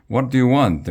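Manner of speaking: testy, loud question; angry dad. A narrator's reading. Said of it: "my dad asked angrily".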